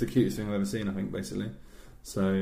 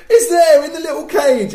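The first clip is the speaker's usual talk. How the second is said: very high, loud mock shriek; hammed-up child's wail; hysterical little sister; 14 dB louder than usual. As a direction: scream it like a sobbing child. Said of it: "and she went".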